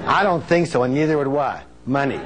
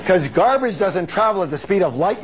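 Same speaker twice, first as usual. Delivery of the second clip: ordinary delivery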